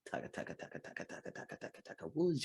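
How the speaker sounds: unvoiced rapid taka drum roll; whispered drum roll. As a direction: tap out a drum roll under your breath. Vocal drum roll sound effect.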